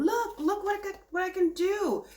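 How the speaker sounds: put-on a child tugging at mom, proud of a new trick; excited kid. Kid calling to mom to show a trick, high and excited. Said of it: "I'm seeing a kid going to my mom".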